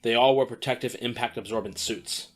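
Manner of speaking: usual speaking voice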